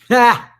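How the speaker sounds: a single loud, high burst of laughter. Real, not acted. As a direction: laugh off the critics in one loud bark. Laughs at his critics.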